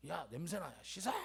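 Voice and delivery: lightly imitated grumbling father; subdued, irritable father impression; gruff angry father. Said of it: "화가 나 있으세요".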